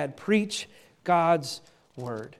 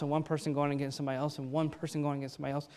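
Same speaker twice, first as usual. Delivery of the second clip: fast, softer rattled-off repetition; rushed mechanical repeat; 4 semitones lower, 10 dB quieter, much faster than usual. Shown as a demonstration. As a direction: tick it off quickly, trailing away. Repeats phrase to show endless adding.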